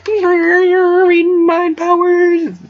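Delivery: very high, slow, loud mystic drone; hammed-up mind-reading chant; mock psychic voice. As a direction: intone it like a spooky mind reader. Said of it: "she's like".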